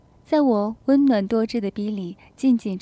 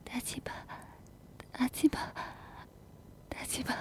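very soft, breath-heavy whisper; barely voiced, hesitant murmur; trembling soft call; 3 semitones higher, 16 dB quieter than usual. A narrator's reading. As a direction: whisper it timidly between breaths. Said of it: "小嘴颤抖地轻声叫道".

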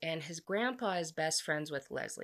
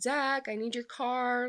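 higher-pitched imitated call to a roommate; mock calling out, drawn out and higher; retold yell; 7 semitones higher, 4 dB louder than usual. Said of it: "he starts yelling for Zach".

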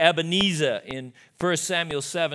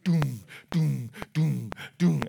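spoken thuds, evenly spaced; imitated door kicks; slower than usual. Boy kicking his bedroom door, imitated thuds. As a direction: mimic dull kicks with your voice. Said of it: "I would kick ... like this".

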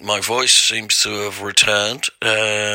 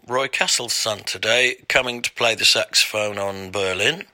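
ordinary delivery

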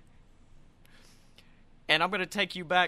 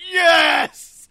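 full yell of triumph; shout; 12 semitones higher, 16 dB louder than usual. Retold or imitated. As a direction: scream it out, triumphant. Retold scream at pyro.

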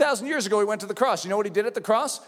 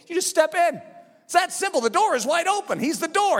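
higher, driving sermon delivery; full-force preaching; 7 semitones higher than usual. Real, not acted. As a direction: preach it with rising fervor.

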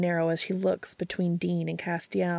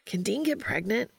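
ordinary delivery, nothing marked

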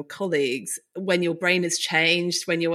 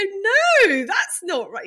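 high, firm, louder no from the therapist; imitating the therapist; 13 semitones higher, 4 dB louder, much slower than usual. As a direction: correct her kindly but firmly. Therapist correcting her.

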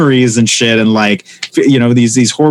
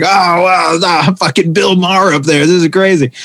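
higher, sarcastic fake heckling; a light mock heckle from the audience seat; mock heckler; 5 semitones higher than usual. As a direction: needle the reader from the front row. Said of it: "I start pretending to heckle".

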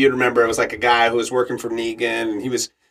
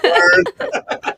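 high, laughing cocky word. Retold or imitated. Actor brushes off agent, laughing high. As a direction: laugh it off coolly. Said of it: "I was like".

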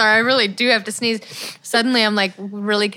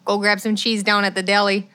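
put-on Midwest accent; 2 semitones lower than usual. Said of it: "My accent's evolving".

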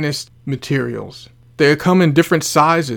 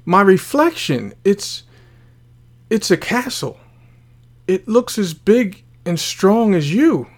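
higher, bewildered plea; mildly overwhelmed, halting in disbelief; tearful confusion; 6 semitones higher than usual. A narrator's reading. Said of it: "tears of confusion began to fall".

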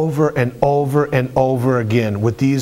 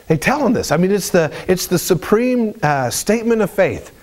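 ordinary talk, nothing marked

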